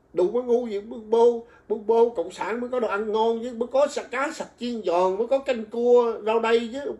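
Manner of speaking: a mocking imitation of food bragging; mock sneer. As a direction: drag out the tasty dishes tauntingly. Mocking pro-Trump viewers' boast.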